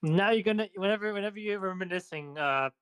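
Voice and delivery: ordinary talk, nothing marked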